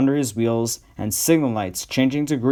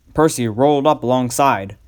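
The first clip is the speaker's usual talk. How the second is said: ordinary delivery, nothing marked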